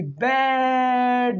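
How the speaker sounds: बेड stretched very slow on the long vowel; बेड with a long drawn ए. Shown as a demonstration. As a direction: hold the ए in बेड for a long time. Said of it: "double मात्रा आएगी".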